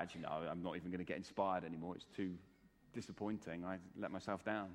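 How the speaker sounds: low, quiet voice of someone let down; cynical. Acted. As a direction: mumble it like a disillusioned cynic. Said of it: "you've become cynical".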